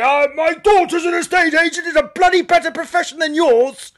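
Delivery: high, loud put-on outraged voice; indignant shout. Woman defending her estate agent daughter to a comic, indignant shouting. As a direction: shout it high, as an affronted parent.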